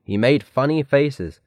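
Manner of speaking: plain reading voice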